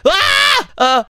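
short high shriek; startled scream. Pretend. Player suddenly falls into the trap, startled scream. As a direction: scream out as the floor gives way.

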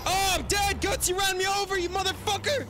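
high, loud yelp of outrage; outraged shout. Real, not acted. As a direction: scream at the driver who ran you over. Run over by teammate, yelling.

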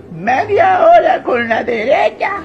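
slow, drawn-out shouting like he's been struggling for ages; desperate straining wail. Acted. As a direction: wail it out, exhausted and desperate, pulling at a stuck lid. Old man straining at the jar. (viejito exasperado gritando despacio, casi llorando)

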